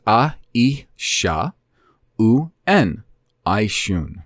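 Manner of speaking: "a, i, sha, u, n" sound by sound, then "I, shun" joined; spelled-out pronunciation. Shown as a demonstration. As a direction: sound out each piece with pauses, then blend "I, shun". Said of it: "I use a pronunciation that is based on".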